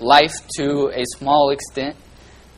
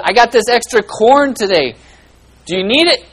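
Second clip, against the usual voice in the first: loud, higher neighbourly offer; friendly neighbour; 8 semitones higher, 8 dB louder than usual. Retold or imitated. Neighbour offering corn to the father, loud and higher. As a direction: call out the offer cheerfully.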